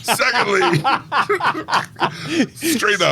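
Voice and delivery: words broken up by giggles; high, giggly delivery; laughing. Real, not acted. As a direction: laugh your way through it. Laughing through the catchphrase bit.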